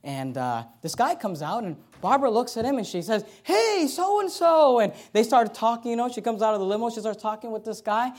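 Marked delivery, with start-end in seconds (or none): 3.4-4.8 high, drawn-out "hey there" hail; stretched, raised-pitch mock greeting; called-out greeting. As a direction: imitate a wife hailing an old friend. Wife greets an old acquaintance.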